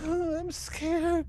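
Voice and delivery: put-on high, tearful little child's voice; a scared small child begging; crying child. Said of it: "the voice of a crying child".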